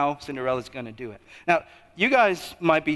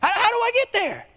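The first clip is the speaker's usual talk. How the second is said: very high, hammed-up frantic question; mock panic. Cinderella wondering how to reach the prince, mock panic. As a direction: squeak it out in pretend desperation.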